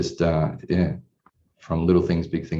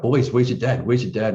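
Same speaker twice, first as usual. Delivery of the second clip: higher mock yell of a wife calling; retold call from the kitchen, pitched up; imitated yelling out; 6 semitones higher, 4 dB louder than usual. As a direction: call out to the boys, half-voiced shout. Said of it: "she's just yelling out".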